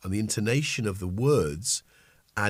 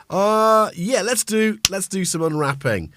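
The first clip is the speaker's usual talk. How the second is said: eager; 11 semitones higher, 8 dB louder than usual. Real, not acted. Starting present unwrapping on stream.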